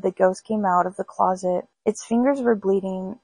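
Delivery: plain reading voice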